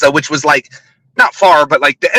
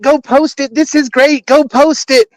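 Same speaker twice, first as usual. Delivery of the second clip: imitated excited scream; 7 semitones higher than usual. Accuser quoting her friend's phone reaction, mock-excited and high. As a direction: mimic her gushing, pitched well up. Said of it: "You screamed like".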